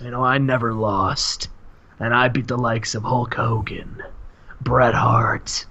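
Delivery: low, measured wrestling promo; the Warrior. Acted. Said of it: "he says".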